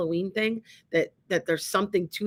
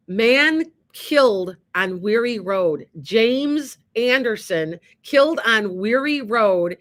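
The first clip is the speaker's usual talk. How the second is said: headline read out slowly and louder, each word weighted; 5 dB louder, slower than usual. Retold or imitated. Reading the death notice to viewers, slow and heavy. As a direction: spell out the grim news word by word.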